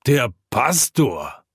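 slow, drawn-out exclamation; mildly startled, drawn-out call; echoed startled call. A narrator's reading. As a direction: echo the cry as a whole group would, stretched out. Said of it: "ruft ebenso die Runde".

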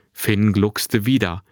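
ordinary delivery, nothing marked

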